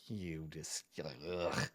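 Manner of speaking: low, very quiet disgusted grunt. Acted. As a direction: mutter it low with revulsion. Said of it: "a disgusted look".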